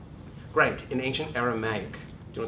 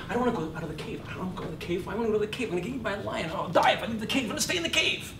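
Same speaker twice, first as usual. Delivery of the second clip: high, timid scared inner voice; fear voice; 10 semitones higher, much faster than usual. Acted. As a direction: whimper like a cave dweller afraid to leave. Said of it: "the fear voice is".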